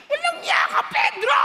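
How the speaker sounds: high mock yell at the husband; imitating a mother in labor yelling at Pedro; mock labor scream. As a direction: ham up a woman giving birth. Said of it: "sisigaw talaga".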